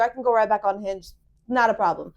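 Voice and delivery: usual speaking voice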